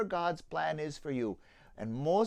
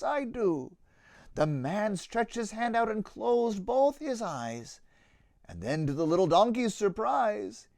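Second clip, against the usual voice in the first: put-on lively rhyming read-aloud for children; story narration; 5 semitones higher, 9 dB louder than usual. A narrator's reading. Narrator reading a children's Easter tale, lively storytelling. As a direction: tell the tale with bounce and warmth in the rhythm.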